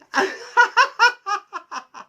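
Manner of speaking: high, breathy demonstration laugh; demo laugh. Shown as a demonstration. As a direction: laugh out on the exhale. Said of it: "even laughing".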